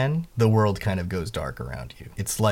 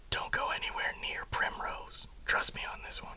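hushed warning in her ear; whisper; 12 dB quieter than usual. Acted. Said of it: "leans into her ear and he says".

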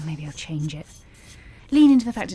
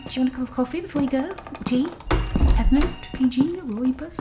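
nervous rush; 8 semitones higher than usual. Acted. Anxious host, rattling offers.

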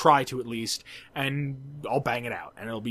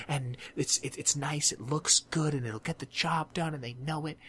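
softer, lower, sing-song mock admiration; mock dreamy description; 3 semitones lower, 6 dB quieter than usual. Teasing the classmates' perfect bridges in a soft mock voice. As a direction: hush it as if describing something precious.

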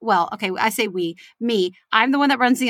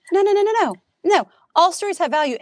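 emphatic reassurance; 9 semitones higher, faster than usual. Retold or imitated. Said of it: "I'm like".

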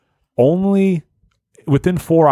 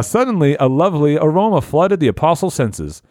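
normal talking voice

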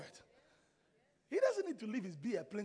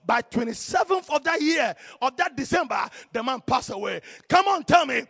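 loud, raised testimony building to a shout; thundering pulpit declaration; full-force preaching; 4 semitones higher, 17 dB louder than usual. Real, not acted. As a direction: punch out each phrase to rouse the congregation.

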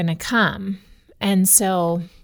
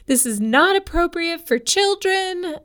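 put-on high, tearful kid voice, much higher; upset little boy; 10 semitones higher than usual. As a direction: squeak it out like a distressed small child. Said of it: "he's just sobbing".

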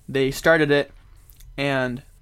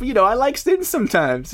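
higher, louder, pleading admission; mock confession; 9 semitones higher, 4 dB louder than usual. Imitating the other host admitting he loves sin, mock confessing. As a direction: confess it like a hammed-up guilty pleasure.

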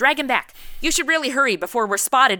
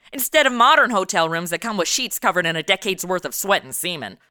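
ordinary talk

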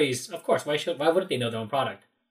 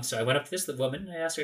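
ordinary talk, nothing marked

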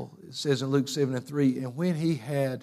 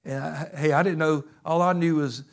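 ordinary talk

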